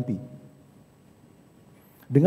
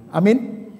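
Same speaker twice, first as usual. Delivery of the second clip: ordinary talk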